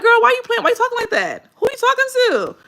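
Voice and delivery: put-on girl, what are you doing, laughing; incredulous friend. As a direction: call out a friend's fake sales voice. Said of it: "I'd be like".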